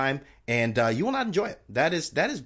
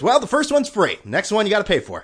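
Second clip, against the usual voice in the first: bartender's catch; 8 semitones higher, 8 dB louder than usual. Retold or imitated. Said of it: "He goes".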